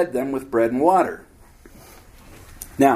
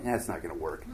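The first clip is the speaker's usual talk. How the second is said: laughing aside; 9 semitones lower, 13 dB quieter than usual. Acted. People muttering doubt.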